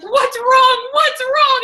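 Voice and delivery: high, loud alarmed asking; husband startled, asking what's wrong. Retold or imitated. Husband alarmed at her crying.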